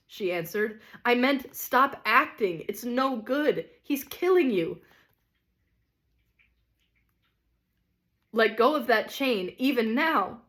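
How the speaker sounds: earnest lady. Acted. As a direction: stop acting, gently firm. Lady urging.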